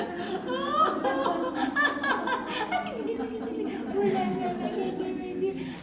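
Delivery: big high belly laugh; loud laugh. Real, not acted. Teacher cracks up after gibberish brushing, loud high laughing. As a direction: let a full high laugh burst out. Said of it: "It makes your inner child laugh".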